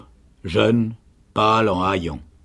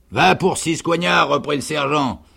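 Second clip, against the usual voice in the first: loud, high concession; conceding sergeant; 7 semitones higher, 4 dB louder than usual. A narrator's reading. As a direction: give in loudly and move on. Sergeant gives in, resumes questioning. (sergent qui concède, voix forte)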